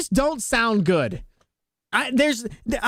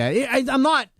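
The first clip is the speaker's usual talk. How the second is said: ordinary talk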